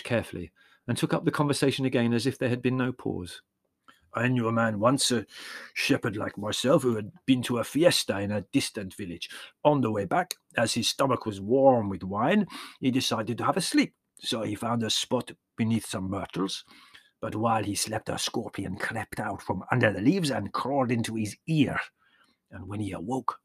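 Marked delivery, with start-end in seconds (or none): 4.1-21.8 put-on warm old peasant shepherd, proud of his trick; old Yanni voice. A narrator's reading. Yanni tells a grim tale. 22.5-23.4 hushed storyteller whisper. A narrator's reading. Storyteller hushes before the sting.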